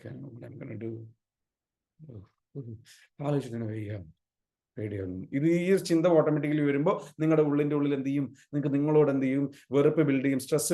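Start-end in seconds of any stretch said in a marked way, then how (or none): 0.0-5.3 imitating anxious self. Voicing a defeated, anxious self.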